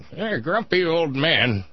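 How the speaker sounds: lightly voiced cranky old man; a hint of a grumpy geezer. Shown as a demonstration. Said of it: "in my, ... voice".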